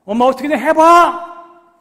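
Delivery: high, loud, slow whining demand; child's tantrum. Acted. Ishmael pestering his mother, high loud whining tantrum. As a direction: throw a tearful fit at mom, slowly. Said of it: "라고 떼도 써봤겠지요".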